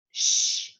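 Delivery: unvoiced "sh" held long on its own; isolated sh sound. Shown as a demonstration. Teacher showing the digraph's phoneme, isolated sh sound. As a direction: say "sh" as one drawn-out hushing sound. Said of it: "is that first sound".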